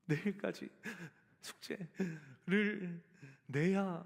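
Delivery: halting, very slow words of a child choking on tears, lightly voiced; imitating sobbing child. Father retelling his crying son's words, mild tearful halting. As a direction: mimic a breathless crying first-grader, understated. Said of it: "숨이 가빠서 막 얘 말을 못하는 거예요".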